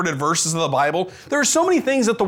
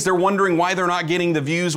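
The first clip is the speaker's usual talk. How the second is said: usual speaking voice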